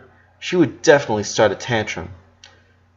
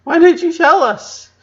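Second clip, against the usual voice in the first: high, loud anguished why; tearful cry; 5 dB louder than usual. Acted. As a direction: cry it out, hurt and pleading. Lola crying, wiping her face.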